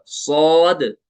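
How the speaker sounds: "सौद" drawn out long and slow, with a full heavy mouth; heavy stretched letter. Shown as a demonstration. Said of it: "इस तरह". ("सौद" को धीरे खींचकर पुर पढ़ें)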